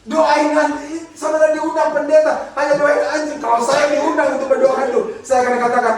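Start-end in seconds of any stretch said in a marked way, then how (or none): none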